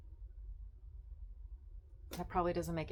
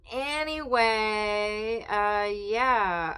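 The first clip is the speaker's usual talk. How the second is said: loud drawn-out; 4 semitones higher, 11 dB louder, much slower than usual. Real, not acted. Loud drawled filler.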